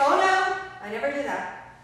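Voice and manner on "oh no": loud, high startled refusal; shocked protest. Acted. Guest on a radio show reacting to faking sex, startled. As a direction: recoil at the idea, loudly.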